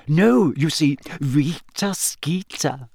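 savoured, drawn-out triumphant reveal; slow, pleased revelation; quiet triumph. A narrator's reading. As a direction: draw out the name in triumph. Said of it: "Hermione's voice trembled with quiet triumph".

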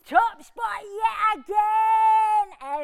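loud, drawn-out triumphant shout; triumphant crowing. Acted. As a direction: crow it to the crowd, stretching the words. Boasting about the show's success.

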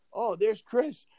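high, mocking gossip voice; quoting colleagues who think he skipped out, teasing. Colleagues in a meeting mock-gossiping about Chris, high and teasing. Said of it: "And they're like".

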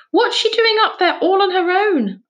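high, loud accusing demand; furious. Acted. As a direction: scold the children furiously. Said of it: "asked Missus Lamb furiously".

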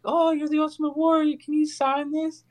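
high-pitched little fan asking for an autograph; excited kid fan. Retold or imitated. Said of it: "some kid comes up and is like".

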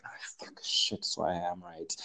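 hushed whisper, barely voiced; whispered curse. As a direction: swear under your breath. Realizes she's right, mutters.

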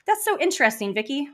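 high, loud sarcastic questioning; mock-sweet confrontation. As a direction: needle the boss with feigned interest. Acted confrontation with her boss.

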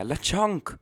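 high squeal of the name; squeaky Pokemon cry. Acted. Calling out Pokemon name gleefully.

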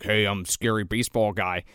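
low, quiet imitation of a slasher; deadpan it low and soft as the killer; mock scary killer. Voices the killer as silly lowlife.

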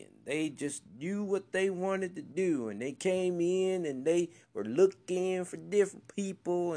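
high, drawling Southern country voice; country boy voice. Pretend. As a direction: slip into a country boy twang. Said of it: "now I'm talking in my country boy voice".

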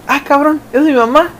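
high-pitched, startled double take with a questioning rise; startled realization. Acted. Kid spots mom pulling up at the Six, startled. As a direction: blurt it as first dawning disbelief. Acting out spotting mom arriving.

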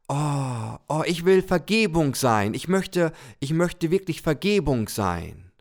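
the little soul, thrilled, announcing its wish; excited little soul. Pretend. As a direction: blurt out the big wish.

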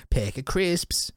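slow, drawn-out mock British; shitty British accent. Shown as a demonstration. Riffing on bad accents, slow hammed-up British. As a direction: stretch the vowels into awful British. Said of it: "like a shitty British accent".

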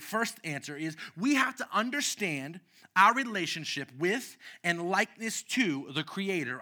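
usual speaking voice

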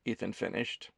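ordinary delivery

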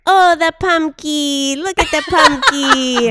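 put-on loud, slow sing-song baby talk; annoying dog voice. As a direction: coo loudly and slowly to a puppy. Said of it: "what's your annoying dog voice?".

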